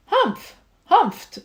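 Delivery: loud, high sniffing humph; scornful humph. A narrator's reading. Sour kangaroo snorting at Horton, scornful humph. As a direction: snort it out with disdain. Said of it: "a voice. 'Twas a sour kangaroo".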